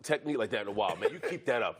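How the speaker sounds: low, chuckling compliment; low teasing praise. Retold or imitated. Big lineman praising the rookie, low and laughing. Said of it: "He's like".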